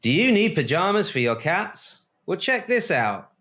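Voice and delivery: high, chipper ad pitchman; chipper 1950s ad. Pretend. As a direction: sell it like an old TV ad. Mock ad for cat pajamas.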